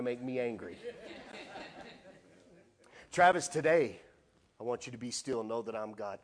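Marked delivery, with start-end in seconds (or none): 3.1-6.2 voice of God. Retold or imitated. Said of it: "the Lord speaking to him".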